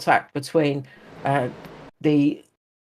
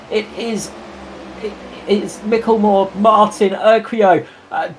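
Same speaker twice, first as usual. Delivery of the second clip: higher, excited play-by-play; motorsport commentator; 7 semitones higher than usual. Acted. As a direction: call the battle lap by lap. Live race play-by-play.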